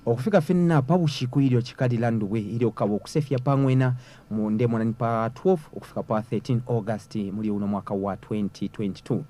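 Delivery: normal talking voice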